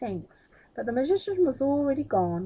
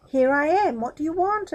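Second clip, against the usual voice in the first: higher, louder genie greeting; 6 semitones higher, 6 dB louder than usual. A narrator's reading. Genie appearing to Aladdin, high and loud. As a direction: pop up eager to serve.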